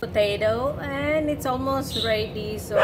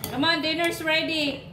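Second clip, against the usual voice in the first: yelling across the room; 6 semitones higher than usual. Real, not acted. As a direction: call him to dinner from afar. Calling son to dinner.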